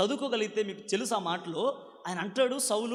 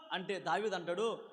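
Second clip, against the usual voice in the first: ordinary delivery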